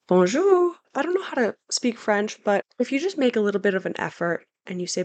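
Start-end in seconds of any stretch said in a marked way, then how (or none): 0.1-0.7 Bonjour much higher and louder, bright and sung out; cheerful bonjour. Shown as a demonstration. Vlogger shows viewers how to greet in Paris, high loud bonjour. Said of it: "always say bonjour".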